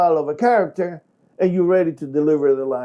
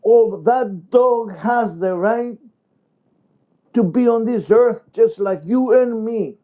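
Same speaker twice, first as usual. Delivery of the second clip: smug neighbor; 8 semitones higher, 3 dB louder than usual. Shown as a demonstration. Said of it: "the other character will say".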